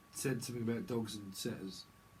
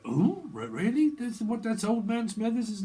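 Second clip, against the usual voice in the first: high, loud incredulous gasp of a question; Scooby gang member reacting to the Smithers reveal, mock surprised; mock surprise; 11 semitones higher, 7 dB louder than usual. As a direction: play it wide-eyed and disbelieving. Mock shocked quote about Smithers.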